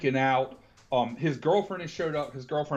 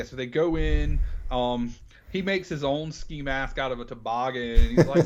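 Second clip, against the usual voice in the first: ordinary delivery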